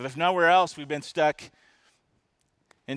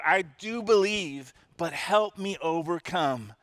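higher, slow, fervent cry of belief; 5 semitones higher, 4 dB louder than usual. Retold or imitated. Desperate father answers Jesus with a fervent cry. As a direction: cry it out as an urgent plea. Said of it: "instantly cried out".

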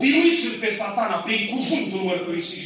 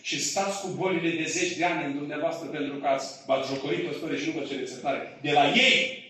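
ordinary talk, nothing marked

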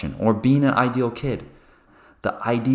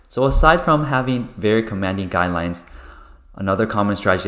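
ordinary talk, nothing marked

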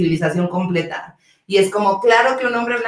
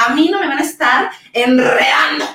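emotional outburst; 5 semitones higher, 4 dB louder than usual. Real, not acted. Passionate rant about respect.

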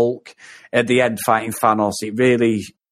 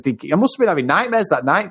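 ordinary delivery

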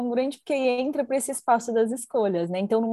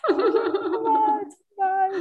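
laughing; 11 semitones higher, much slower than usual. Real, not acted. Laughing repeat of phrase.

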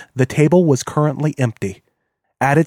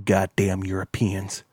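slow, softer muttered sneer; 4 semitones lower, 7 dB quieter, slower than usual. A narrator's reading. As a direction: spit it out with contempt, quietly. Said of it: "Kane sneered".

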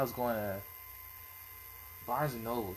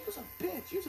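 high, soft-volume imitation of a screaming girl; mock screaming; 6 dB quieter, faster than usual. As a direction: mimic her screeching insult without real volume. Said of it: "She's screaming at the top of her lungs".

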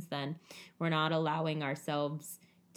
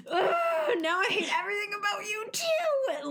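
sudden high crying shout; exploding outburst. Retold or imitated. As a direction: let it all burst out at them. Snapping at a loved one.

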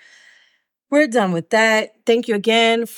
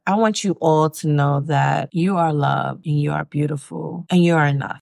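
ordinary delivery

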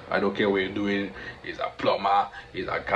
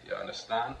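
ordinary talk, nothing marked

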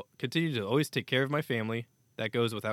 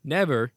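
NEVER louder and higher, falling; never in all caps; 4 semitones higher, 8 dB louder than usual. Shown as a demonstration. Host reading the statement aloud, NEVER punched like caps. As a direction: read never like capital letters. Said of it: "in all caps".

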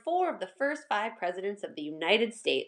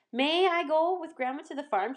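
mildly eager plea, a bit higher; a child gently begging to go along; excited pleading; 7 semitones higher than usual. A narrator's reading. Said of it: "she pleaded excitedly".

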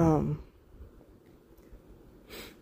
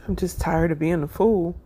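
ordinary talk, nothing marked

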